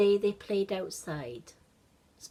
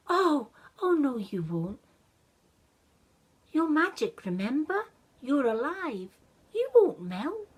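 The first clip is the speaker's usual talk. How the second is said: put-on higher, louder child voice, pleading in alarm; a child refusing to let his snowman melt; upset little boy; 7 semitones higher, 5 dB louder than usual. Child comforts melting snowman.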